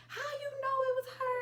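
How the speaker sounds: a teasing, squeaky imitation of Bob; high squeaky tease. Imitating Bob asking teasingly, high and fast. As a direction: pitch way up and rush it.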